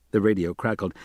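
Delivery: ordinary delivery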